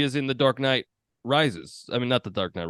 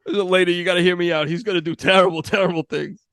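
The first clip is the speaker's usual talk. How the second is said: raised, higher-pitched mock plea; lightly hammed-up urgent appeal; mock pleading; 6 semitones higher, 6 dB louder than usual. Time traveler reasoning with the baby's mother, mock pleading.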